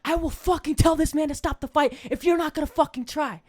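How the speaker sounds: high, forceful threat; mock furious coach. Coach threatening his lazy fighter, mock anger. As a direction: threaten to stop the fight in earnest.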